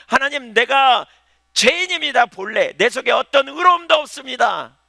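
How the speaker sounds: high, loud, slow cry to God; loud pleading prayer; pleading confession. Acted. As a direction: cry out your sin to God. Voices a sinner's confession prayer.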